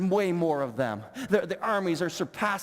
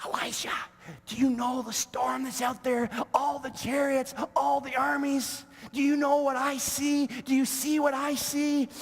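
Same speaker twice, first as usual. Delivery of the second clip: panicked servant; 6 semitones higher than usual. Retold or imitated.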